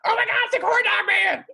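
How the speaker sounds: high laughing shout of alarm; freaking out. Retold or imitated. Coworkers freaking out over the corn dog man, high laughing shout. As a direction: squeal it out like staff spotting a wanted man. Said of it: "they started freaking out".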